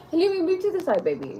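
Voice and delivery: high laughing plea to the cat; giggly coaxing, high. Real, not acted. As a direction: laugh while shooing her aside. Laughing while shooing the cat.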